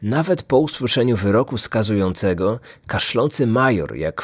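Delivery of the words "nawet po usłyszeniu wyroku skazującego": ordinary delivery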